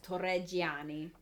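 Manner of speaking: Torreggiani said slowly and clearly as tor-reh-JAH-nee; correct pronunciation. Shown as a demonstration. Friend corrects how her name is said: Torreggiani spelled out in sound. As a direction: model the name Torreggiani carefully, every syllable. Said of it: "it's supposed to be pronounced".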